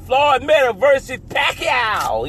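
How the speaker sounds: high hyped-up shout. Real, not acted. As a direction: call it like a fight announcer. Hyping boxing matchup.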